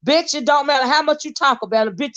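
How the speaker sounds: usual speaking voice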